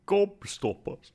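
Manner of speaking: slow, bad British accent. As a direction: say it like a Brit, badly. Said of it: "That wasn't a very good, uh, English accent".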